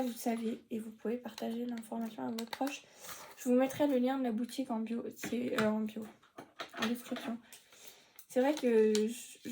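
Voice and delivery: ordinary delivery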